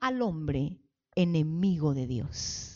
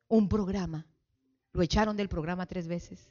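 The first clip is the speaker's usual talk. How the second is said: ordinary talk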